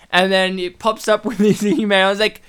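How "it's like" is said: ordinary talk, nothing marked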